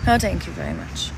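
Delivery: ordinary delivery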